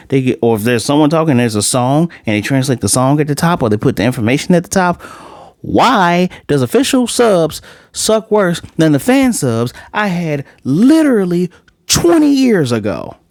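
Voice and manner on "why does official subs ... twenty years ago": exasperated rant. Real, not acted. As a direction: spell out the grievance word by word, pitch climbing. Ranting about bad official subtitles.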